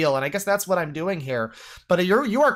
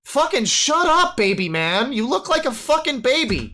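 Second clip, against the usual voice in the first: angry outburst; 7 semitones higher than usual. Real, not acted.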